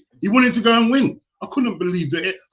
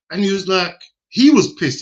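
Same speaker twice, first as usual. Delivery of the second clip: louder and higher; worked up; 4 semitones higher, 6 dB louder than usual. Real, not acted. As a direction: get heated retelling it. Retelling angry Leeds-fan dad.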